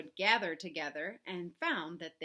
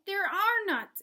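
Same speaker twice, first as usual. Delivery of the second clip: much higher, loud, dismayed exclamation; exclaimed; 10 semitones higher, 8 dB louder than usual. A narrator's reading. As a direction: cry out about lost nuts. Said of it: "exclaimed Bruce".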